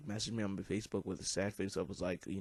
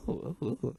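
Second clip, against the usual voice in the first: happy oh oh; 4 semitones higher than usual. Retold or imitated. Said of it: "I was kinda happy".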